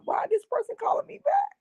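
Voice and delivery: high, rising, baffled and a bit panicky; baffled alarm. Retold or imitated. Wondering aloud why the stranger calls her back, high and baffled. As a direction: squeak it out in disbelief.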